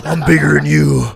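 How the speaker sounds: heavy-breathing wrestling promo, slow and loud; loud, drawn-out mock wrestler threat; Macho Man. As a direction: imitate a pro wrestler cutting a threatening promo. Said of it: "he's talking like the Macho Man".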